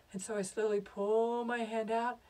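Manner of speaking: plain reading voice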